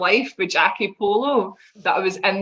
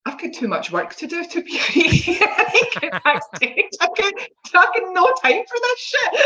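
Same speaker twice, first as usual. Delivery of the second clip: laughing inner thought; 9 semitones higher than usual. Retold or imitated. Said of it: "being out in the ring and thinking".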